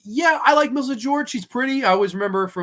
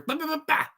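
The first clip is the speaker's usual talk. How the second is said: mock jump scare; 10 semitones higher, faster than usual. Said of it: "weird sporadic cuts".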